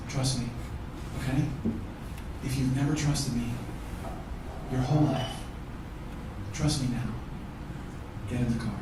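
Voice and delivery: low, soft coaxing with pauses; calm reassurance. Retold or imitated. Husband asking his wife to trust him, quietly reassuring. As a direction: gently talk someone round, softly and low.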